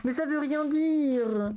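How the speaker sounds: high, drawn-out complaint; exasperated protest. Real, not acted. Complaining that hums are no answer, exasperated. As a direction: stretch it into a long exasperated whine.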